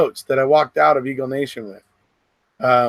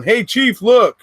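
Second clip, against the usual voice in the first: mock alert call, pitched up; imitating calling out; 8 semitones higher, 5 dB louder, slower than usual. Lieutenant flags down the chief at a raid, mock excited call-out. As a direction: call the chief over, higher, louder on the last word. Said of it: "Hoffer's going".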